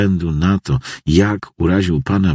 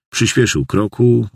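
usual speaking voice

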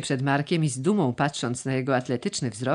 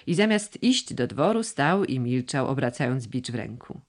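ordinary delivery

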